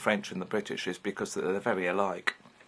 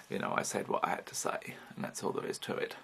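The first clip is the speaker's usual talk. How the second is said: hushed whisper; 3 semitones lower, 7 dB quieter than usual. Real, not acted. Defiant aside.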